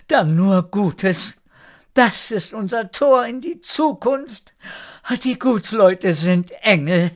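high, weepy character voice; acted sobbing overjoyed mother; tearful call. A narrator's reading. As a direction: cry with emotion while calling back. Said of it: "Erika weinte und rief zurück".

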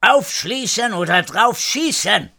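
high-pitched shouted threat; thundering call. A narrator's reading. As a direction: call it out sharply at the door. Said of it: "donnerte erneut rufend gegen die Tür". (donnernd rufen)

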